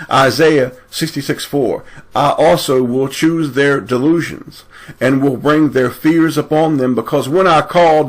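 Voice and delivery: fired-up exhortation from the pulpit; forceful preaching. Acted. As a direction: preach it hard, pitch up high. Proclaims scripture at full force.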